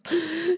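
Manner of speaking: short, high giggle; high laugh. Real, not acted. Thanking Alex with a short high giggle.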